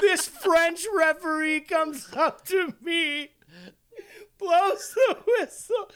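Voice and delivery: high, slow, laughing through the words; crying with laughter while telling it. Real, not acted. Telling a friend the story, crying with laughter.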